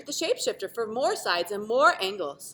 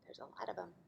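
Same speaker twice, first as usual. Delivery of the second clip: whisper; 2 semitones lower, 19 dB quieter, faster than usual. Real, not acted. Aside to children.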